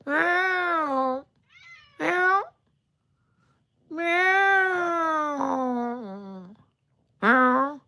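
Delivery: very high drawn-out cat meows; imitated meow. Owner meowing back at his cat in a very high voice.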